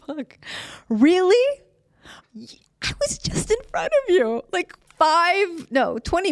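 high, incredulous squeal of disbelief; disbelieving outburst. Real, not acted. Caller on hold recognizes the same clerk, squeaking in disbelief. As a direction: voice jumps up in shocked disbelief.

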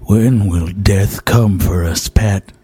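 gloomy low lament. Acted. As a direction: mourn it like a tired old drunk. Weary old drunk laments.

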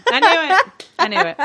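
gleeful I knew it; excited. Real, not acted. Triumphant at solving mystery.

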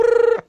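high, loud trilled ring; imitated phone ring. Host mimes phoning China, trilled ring noise.

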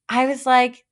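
ordinary talk, nothing marked

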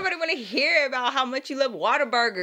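high-pitched mock scolding; exasperated telling-off. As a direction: scold her like a fed-up relative. Acted telling-off of the cousin.

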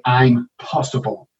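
slow, "I'm possible" as two separate words; split into two words. Shown as a demonstration. Telling a patient impossible means I'm possible, slowly. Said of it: "you forgot the apostrophe".

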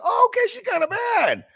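high, impressed "oh, okay"; a pleasant little double take; pleasantly surprised. Retold or imitated. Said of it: "I'm like".

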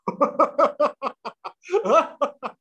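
high, wild maniacal cackle; maniacal laugh. Shown as a demonstration. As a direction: let a possessed laugh burst out high. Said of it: "with that kind of laugh, but worse".

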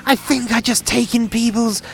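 high, eerie villain confession; Dickhead voice. Acted. Villain explaining his soul-stealing tech to the detective, high and eerie. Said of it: "you're sounding a bit like Dickhead right now".

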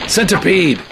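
alarmed shout; crying out. Acted. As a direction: yell the warning as you aim. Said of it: "I cried".

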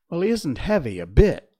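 loud, eager rush to reassure. A narrator's reading. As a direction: jump in keenly to convince the stork. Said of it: "said Dorothy eagerly".

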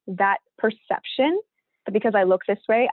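usual speaking voice

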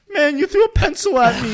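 high, loud mock complaint to a friend; imitated kid outburst. Kid once his friend is on the phone, mock outburst. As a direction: drop the politeness and whine it up high. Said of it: "then right when you come on".

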